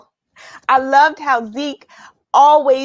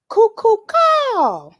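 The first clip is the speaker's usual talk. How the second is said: high, slow birdlike call; blue jay call; 12 semitones higher, 5 dB louder, much slower than usual. Retold or imitated. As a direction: sing it out like a bird calling. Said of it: "The Blue Jays called".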